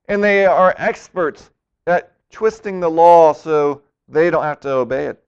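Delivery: usual speaking voice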